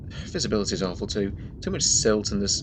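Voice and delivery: ordinary talk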